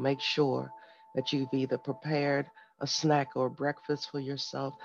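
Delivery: normal talking voice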